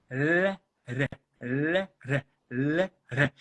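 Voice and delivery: alterne devagar os sons R e L; alternando R e L. Shown as a demonstration. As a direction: repita R, L, R, L para sentir o ponto. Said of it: "Se você ficar alternando aqui". (alternando R e L lentamente)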